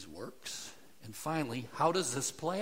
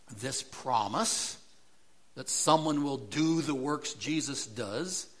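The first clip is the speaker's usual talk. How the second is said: normal talking voice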